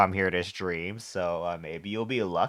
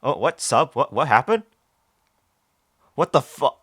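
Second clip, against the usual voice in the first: surprised; 5 semitones higher, 7 dB louder than usual. Real, not acted. Sudden alarm at chat event.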